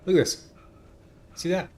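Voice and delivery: high, loud and eager; excited. Real, not acted. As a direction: show it off with excitement. Excited showing off the jumping.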